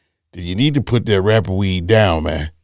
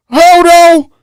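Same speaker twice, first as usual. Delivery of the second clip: sudden loud stop; 9 dB louder, much slower than usual. Real, not acted. Sudden interruption.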